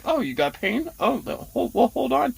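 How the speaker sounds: half-hearted imitation of a fussy dentist; a hint of the dentist stalling mid-extraction; mock dentist. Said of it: "I don't know why he was like".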